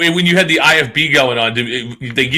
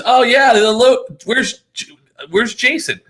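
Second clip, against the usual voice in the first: high-pitched, drawn-out asking; gush, then search for someone better; mimicked eager greeter; 8 semitones higher, slower than usual. Wife mimicking people who want Jason, raised voice.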